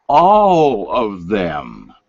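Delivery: ALL stretched long and pressed; drawn-out emphasis. Real, not acted. Vlogger insisting on the orbs' color, drawn-out emphasis. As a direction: draw out "All" slowly, with weight. Repeats for emphasis after whispering.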